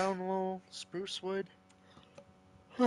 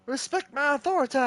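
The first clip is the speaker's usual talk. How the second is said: fast, higher, hammed-up command; mock commanding quote; 5 semitones higher, faster than usual. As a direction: bark it like a cartoon tyrant. Mimics cartoon authority line.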